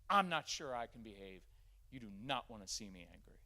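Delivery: usual speaking voice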